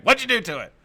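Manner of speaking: high, loud imitation of irate dad; angry accusation. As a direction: demand it like a father who's been tricked. Retold dad accuses son by phone.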